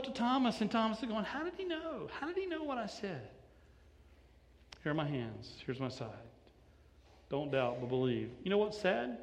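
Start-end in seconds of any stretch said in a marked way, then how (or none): 1.2-3.3 much higher and far softer, awestruck; stunned whisper. Acted. Thomas stunned that Jesus knew. 4.8-8.3 low, soft, reassuring; Jesus softly inviting Thomas to touch; tender invitation. Acted.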